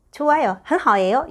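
ordinary talk, nothing marked

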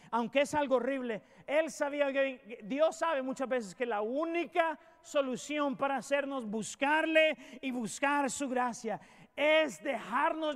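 fervent pulpit delivery; preaching at full force. Acted. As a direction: drive each phrase home to the flock. Preacher building up the sermon.

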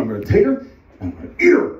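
fast, high shouted threat; hammed-up raging bear; angry bear threat. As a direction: burst into a mock-furious yell. Bear threatens to eat woman.

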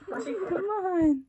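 high, loud coaxing voice for a pet; sing-song calling to the dog; pet talk. Real, not acted.

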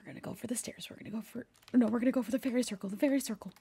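very soft, secretive planning; hushed muttering. Real, not acted. Player planning escape from demon, hushed muttering.

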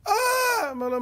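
usual speaking voice

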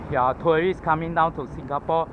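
usual speaking voice

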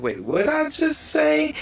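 high, slow puzzled squeak; confused self. Retold or imitated. Vlogger mimics himself editing, high baffled squeak. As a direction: play your own baffled self while editing. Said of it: "I'm like".

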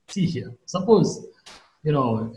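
normal talking voice

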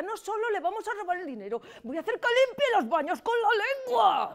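vengeful outburst. Acted. Accomplice vowing revenge on the boss, over-heated. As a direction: rant building to a high yell. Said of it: "tampoco hay que ponerse así".

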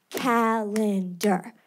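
CALENDAR stretched out slowly, syllable by syllable. Shown as a demonstration. Teacher clapping out syllables, slowly breaking up "Calendar". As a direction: sound out "Calendar" slowly in separate beats. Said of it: "This is how they taught us".

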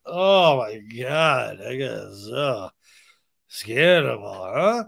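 very slow, slurred pretend voice; hammed-up out-of-it drawl; drugged stupor. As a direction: drag it out low and woozy. Imagined drugged cast on the floor.

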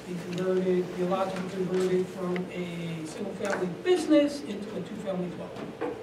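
ordinary talk, nothing marked

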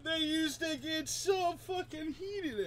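high, slow, squeaky with laughter; drawn-out high laughing voice. Real, not acted. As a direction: squeeze the words out while cracking up. Still laughing at the news.

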